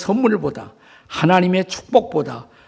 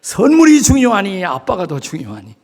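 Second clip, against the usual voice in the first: louder mock scolding from a father; pretend shout; 3 semitones higher, 4 dB louder than usual. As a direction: act out the yell held back from your child. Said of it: "소리치고 싶었어요". (아이를 나무라듯 소리치는 흉내)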